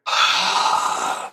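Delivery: empty the lungs with a long sigh; long airless exhale. Shown as a demonstration. Coach emptying the lungs to show the exercise, long exhale. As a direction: push every bit of air out in one breathy sigh. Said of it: "Now get rid of all your air".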